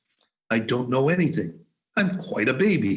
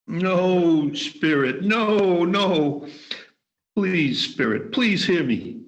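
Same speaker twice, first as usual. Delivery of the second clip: frantic, raised pleas of no; desperate pleading; 5 semitones higher, slower than usual. Acted. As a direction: beg the spirit in terror. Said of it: "As he pleaded with the spirit".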